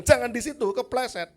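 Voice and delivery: high, loud exasperated shout; exasperated yell. Retold or imitated. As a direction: yell the warning, losing patience. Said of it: "Umur lima belas tahun saya bilang".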